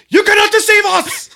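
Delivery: rally the plane against the crew; defiant old man. Retold or imitated. Old man with cane stands up.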